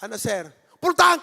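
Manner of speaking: put-on loud, sharp shout at the gas boy; hot-headed driver yelling at gas boy; angry yell at attendant. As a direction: snap at him like a furious driver.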